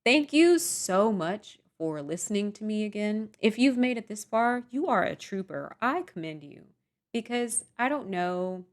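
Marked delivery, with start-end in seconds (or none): none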